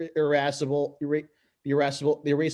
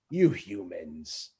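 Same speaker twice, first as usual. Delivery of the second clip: much lower, very slow sneer; sinister villain; 8 semitones lower, 5 dB quieter, much slower than usual. Acted. Koopa sneering at Mario, slow and menacing. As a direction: drag out the contempt.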